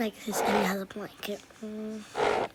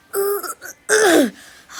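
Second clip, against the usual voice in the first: high, loud wail of distress; much louder hammed-up cry; loud panicked cry; 10 semitones higher, 13 dB louder than usual. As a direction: let out a desperate pretend cry. Panicked pretend rescue.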